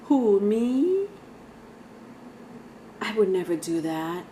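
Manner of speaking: a passive aggressive ex feigning innocence; mock innocent. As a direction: play dumb, all sweetness and denial. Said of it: "somebody here is very passive aggressive".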